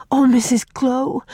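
put-on high, alarmed child; breath-caught panicky girl; gasping. A narrator's reading. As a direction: gasp it out in fright. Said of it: "gasped Faith".

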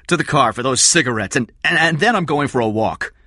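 high, loud, stumbling retort; agitated Eddie. Acted. Eddie leaving Kathy for cigarettes, agitated.

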